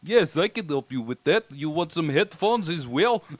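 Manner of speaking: light mock Russian accent of a store clerk; a hint of Russian, helpful clerk. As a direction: customer service clerk in a playful Russian accent. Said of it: "do a Russian accent for a whole day at work".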